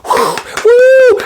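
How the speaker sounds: high loud woo; cheering. A narrator's reading. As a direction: whoop with delight. Cheering after show.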